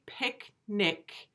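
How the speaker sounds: picnic split into "pick", pause, "nick"; syllable division. Shown as a demonstration. Teacher showing where the division is, split in two. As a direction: say picnic slowly with a clear gap mid-word. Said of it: "notice where the division in the syllables is".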